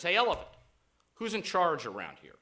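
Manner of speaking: very loud hail, high then dropping in pitch; bossy lion's challenge. Acted. Lion confronting the bull elephant, bossy loud challenge. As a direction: throw down a cocky challenge.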